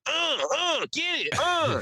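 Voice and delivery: high-pitched grunting egging-on; mock fight cheering. Imagining himself in the back of a brawl, mock cheering.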